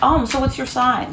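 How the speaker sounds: feigned casual. Retold or imitated. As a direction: slip the question in oh so casually. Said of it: "and, you know, casually".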